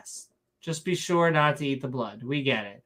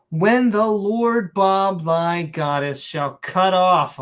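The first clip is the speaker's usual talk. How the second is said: slow, loud, pompous fake-Shakespearean proclamation; mock Shakespearean accent; 3 semitones higher, 5 dB louder, slower than usual. Announcing the Lord Bob's wrath, grand mock Shakespearean accent. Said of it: "saying it in a Shakespearean accent".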